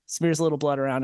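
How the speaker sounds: ordinary talk, nothing marked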